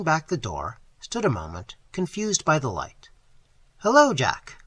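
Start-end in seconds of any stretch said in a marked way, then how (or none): 3.8-4.6 loud, higher hail; cried out. A narrator's reading. West hailing Jack as he walks in late. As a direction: call out a greeting to a late friend. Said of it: "cried West".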